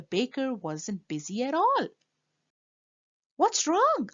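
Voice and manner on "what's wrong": put-on boy's question. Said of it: "asked Stanley".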